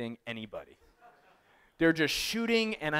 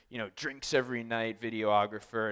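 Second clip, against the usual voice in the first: ordinary delivery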